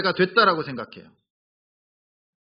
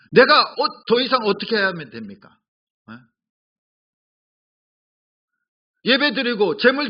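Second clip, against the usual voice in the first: raised, higher voice talking back to God; mock indignant protest of the people; arguing back; 3 semitones higher than usual. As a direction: demand it, a touch indignant. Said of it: "지금 이스라엘 백성들이 따지고 있는 거예요".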